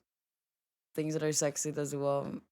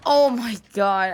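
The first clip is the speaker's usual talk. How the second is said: a drawn-out loud real exclamation; loud slow oh my god; 3 semitones higher, 10 dB louder, slower than usual. Real, not acted. Streamer loudly reacting to the bot's "brethren", drawn out. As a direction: let it burst out, stretched and loud.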